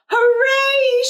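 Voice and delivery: high, loud cheer of joy; excited kid's cheer; yelled hooray. A narrator's reading. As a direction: shout it out like a happy kid. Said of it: "she yelled".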